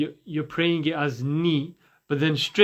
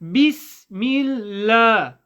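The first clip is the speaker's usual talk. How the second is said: higher, sustained chanting tone; Quranic recitation; 6 semitones higher, 6 dB louder, much slower than usual. Shown as a demonstration. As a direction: recite slowly with long held vowels. Recites the first part.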